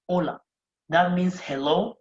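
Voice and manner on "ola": "hola" starting straight on the O; "hola" pronounced "ola"; silent H. Shown as a demonstration. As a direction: model "hola" without sounding the H. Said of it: "So H is silent".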